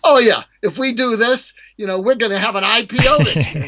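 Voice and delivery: high, gushing with excitement; a prospect carried away by a big idea; excited prospect. Acted. Salesman acts out an eager prospect, excited and gushing.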